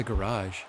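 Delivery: garage as guh-RAHZH, stress at the end; American pronunciation of garage. Retold or imitated. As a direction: repeat the cousin's guh-RAHZH. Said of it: "my cousin the other day said garage".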